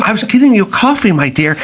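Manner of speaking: high, fast simpering reply; mock dutiful woman; sweet secretary. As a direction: sweeten it like a fifties wife. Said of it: "acted radio scene".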